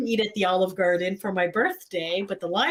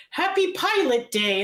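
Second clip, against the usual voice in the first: high, slow, drawn-out announcement; hammed-up celebratory call; imitated cheery greeting; 8 semitones higher, slower than usual. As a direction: mimic a gleeful holiday wish. Imitating his social media greeting.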